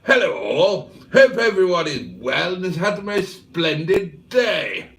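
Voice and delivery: posh voice. Retold or imitated. Reading a viewer's chat message in a posh English accent. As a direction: put on a posh English voice for Neil's hello. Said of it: "'cause Neil is very posh".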